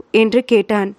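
ordinary delivery, nothing marked

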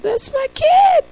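dad freaking out over his newborn; flipping out. Retold or imitated. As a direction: lose it with pride. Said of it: "You flip out".

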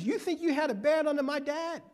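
put-on high, taunting boast of a cocky young king; a brash young ruler mocking his subjects, pitched well up. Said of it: "In other words".

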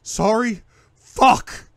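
loud, high enraged shout; furious shout. Acted. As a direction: spit it back at her. Furious outburst.

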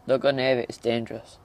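ordinary delivery

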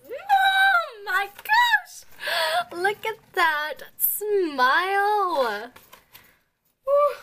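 slow, high, loud shriek; real fan shriek; delighted shriek. Real, not acted. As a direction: melt and scream at the smile. Sees [name]'s smile, screams.

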